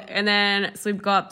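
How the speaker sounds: ordinary talk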